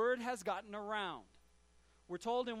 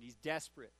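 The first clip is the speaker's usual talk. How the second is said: usual speaking voice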